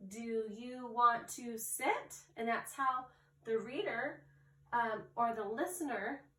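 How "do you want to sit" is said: slow reading, voice climbing on SIT; question rising at the end. Shown as a demonstration. Teacher demonstrates a question mark, voice rising at the end. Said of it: "have your voice go up at the very end".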